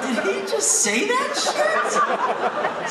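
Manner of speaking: very high, slow, loud astonishment; shocked high squeal; stunned disbelief. Retold or imitated. His shocked reaction.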